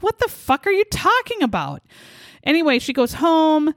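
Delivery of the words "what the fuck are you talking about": incredulous. Retold or imitated. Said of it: "she's like".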